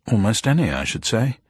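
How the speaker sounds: plain reading voice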